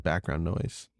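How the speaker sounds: ordinary delivery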